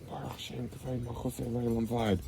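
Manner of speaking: very soft, hushed recitation; barely-voiced, secretive prayer; quiet prayer. Shown as a demonstration. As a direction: say the Hebrew line under your breath. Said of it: "So we say it quietly".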